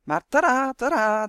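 loud, high fanfare; merry chattering. A narrator's reading. Elf reveals the liberation army, chattering merrily. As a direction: announce it like a grand reveal. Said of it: "kwetterde ondertussen lustig door".

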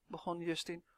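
usual speaking voice

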